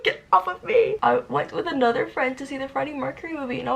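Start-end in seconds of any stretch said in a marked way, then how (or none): 0.0-1.0 mock irritated snap, pitched much higher and louder; annoyed. Shaking off a friend's comforting tap at the movies, mock annoyed. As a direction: brush them off, half laughing. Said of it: "I was like".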